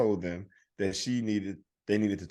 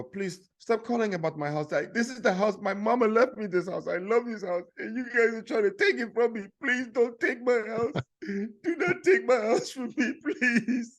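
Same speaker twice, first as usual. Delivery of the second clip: put-on pleading old lady; 12 semitones higher than usual. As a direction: beg the callers not to take the house. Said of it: "And I go".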